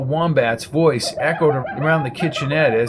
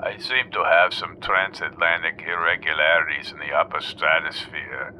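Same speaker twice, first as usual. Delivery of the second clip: much lower, hushed pompous expert; pompous weatherman; 9 semitones lower, 3 dB quieter than usual. A narrator's reading. Dr Edinger excusing his forecast on the radio, low and pompous. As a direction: lecture in a low droning tone.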